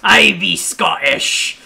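slow, loud hammed-up pirate brogue; pirate Scottish voice. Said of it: "sounds like a pirate in my head".

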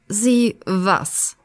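mock her by aping her words; lecturer's mocking echo of a student; sneering imitation, mildly done; mimicking mockingly. A narrator's reading. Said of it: "äffte Havering sie nach".

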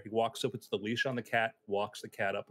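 usual speaking voice

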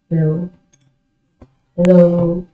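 ordinary talk

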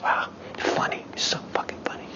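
breathless retold whisper of a star-struck fan. Fan whispering praise to the actor at the shop, hushed. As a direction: hush it like a secret compliment.

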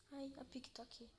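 hushed unvoiced whisper, hiding. Real, not acted. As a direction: whisper so the Pig can't hear. Hiding from the Pig.

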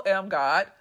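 ordinary delivery, nothing marked

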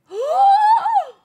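loud high squealed gasp; delighted shrieking intake; excited gasp. Real, not acted. Excited gasp at shop item.

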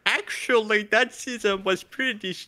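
high, snooty imitation of a know-it-all commenter; mocking commenter voice. Mocking commenters who call the season terrible, snooty high voice. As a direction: sneer it like a smug reply guy.

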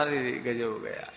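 low, soft, slow mock lament; understated "what a disaster" groan; mock dismay. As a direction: let it sink into hushed, feigned dismay. Acts out the believer's dismay.

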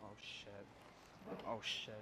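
whispered repeated oh shit; breathless hushed panic, over and over. Real, not acted.

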